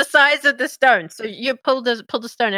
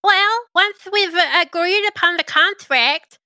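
higher, eager little servant; imp voice; 8 semitones higher, slower than usual. Acted. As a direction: play a bound imp, brightly.

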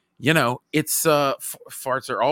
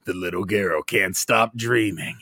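low, slow dramatic trailer narrator; movie trailer voice; 6 semitones lower, slower than usual. Pretend. Friend pitching the toddler story as a movie, trailer narrator. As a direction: boom it like an epic film trailer. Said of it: "it's like a movie trailer".

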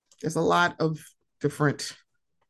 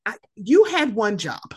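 exasperated; 10 semitones higher than usual. Retold or imitated. Exasperated mother repeats complaint.

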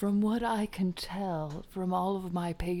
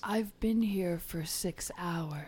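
whispering; 4 dB quieter than usual. Acted. Mystic healer whispering.

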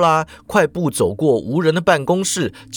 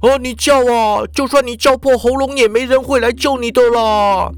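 gloating lecherous boss; 7 semitones higher than usual. A narrator's reading. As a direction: dare the victim mockingly. Ikeda taunts his victim. (得意嘲弄的色社长)

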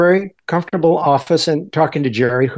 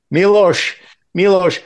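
higher-pitched generic Eastern European accent; 5 semitones higher than usual. Shown as a demonstration. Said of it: "can you do the accent still".

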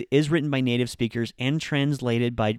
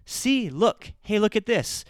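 put-on high, louder eager child showing a drawing; a kid begging mom to look at the picture; excited kid; 9 semitones higher, 3 dB louder, slower than usual. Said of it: "they'll say".

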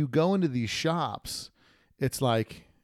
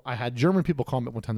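usual speaking voice

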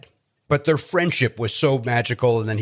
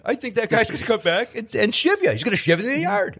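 higher, hammed-up doom-saying; mock ominous warning; 10 semitones higher than usual. Host teasing guest about a bully's revenge, mock ominous. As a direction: warn him he'll get shivved, teasing.

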